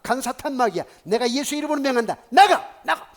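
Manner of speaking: loud, high-pitched exorcist's shout; forceful preacher casting out a demon; shouted exorcism command. Retold or imitated. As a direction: order the demon out at full volume, building to a yell. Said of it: "막 소리 지르잖아요".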